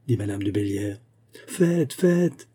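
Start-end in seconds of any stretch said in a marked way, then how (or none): 1.5-2.5 high-pitched eager cry, moderately voiced; urging exclamation. A narrator's reading. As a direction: urge him on as a crowd of friends would. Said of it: "s'écrièrent tous les amis". (exclamation pressante, voix haut perchée)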